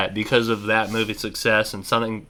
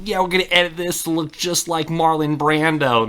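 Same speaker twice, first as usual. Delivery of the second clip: higher put-on voice for a schemer; mock film editor; 6 semitones higher than usual. Imaginary guy behind the scenes plotting an edit, mock voice.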